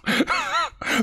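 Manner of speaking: falsetto giggle; high-pitched cackle; high squeaky laugh. Real, not acted.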